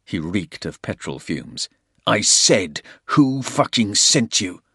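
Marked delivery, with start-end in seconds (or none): 2.1-4.6 loud, furious repeated question; angry demand. A narrator's reading. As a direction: shout the demand in his face. Porter shakes the captive.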